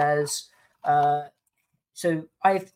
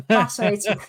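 sarcastic; 4 semitones higher, 6 dB louder than usual. Real, not acted. Sarcastic aside.

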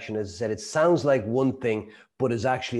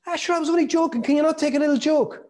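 much higher, sing-song mock innocence; mocking only joking; 3 dB louder, faster than usual. Slagger mocking the hurt person, high, sneering mock innocence. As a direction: brush it off in a high taunting voice. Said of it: "they go".

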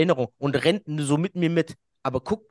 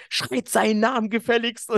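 mock overexcited; 10 semitones higher than usual. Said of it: "so voll überdreht".

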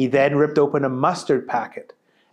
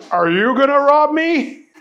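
higher goofy nervous question; higher silly voice, puzzled; Booger voice; 11 semitones higher, 5 dB louder than usual. Acted. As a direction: ask like a confused clown. Booger speaks to Chet.

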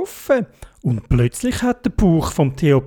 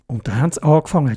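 plain reading voice

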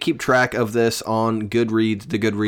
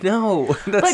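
laughing; 10 semitones higher than usual. Real, not acted. Teasing retelling, laughs mid-word.

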